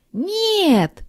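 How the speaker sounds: the kittens yell together; shout. A narrator's reading. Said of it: "закричали котята". (хором кричат, намного выше)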